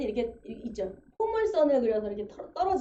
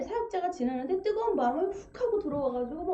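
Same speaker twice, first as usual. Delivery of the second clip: ordinary talk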